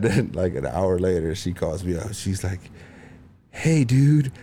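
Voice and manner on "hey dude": higher mock stoned mom greeting; higher voice imitating his stoned mom. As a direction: drawl out a high mom's hey dude.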